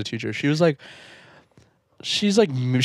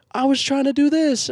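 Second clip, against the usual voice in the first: insolent; 11 semitones higher than usual. Retold or imitated. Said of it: "I'm like".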